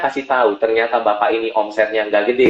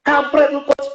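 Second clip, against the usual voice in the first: much higher, brash mock insult; blunt street greeting; 14 semitones higher, slower than usual. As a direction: imitate a rough blunt businessman. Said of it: "Dia bilang begini".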